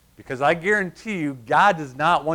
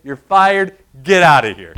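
loud, firm dismissal; mock-stern boss; firing someone; 11 dB louder than usual. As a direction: boom it like a boss firing you. Boss firing the cocky pastor.

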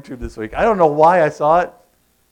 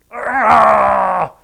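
loud, raised cry of frustration; gnashing-teeth groan; 4 semitones higher, 5 dB louder than usual. Shown as a demonstration. Preacher showing the congregation gnashing of teeth, loud agonized cry. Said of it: "that idea of gnashing of teeth".

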